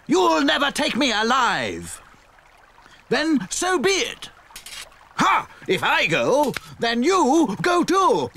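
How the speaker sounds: high, slow hammed-up swordfight cry; stage swordsmen defying each other; theatrical duel. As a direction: ham up the villain. Sword fighters' duel lines.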